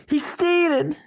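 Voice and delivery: high shouted warning. Shown as a demonstration. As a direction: yell it out for the speaker. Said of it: "I'm just gonna shout".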